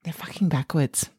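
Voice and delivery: hushed whisper. Real, not acted. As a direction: breathe it like a secret. Whispered shocked reveal.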